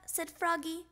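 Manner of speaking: plain reading voice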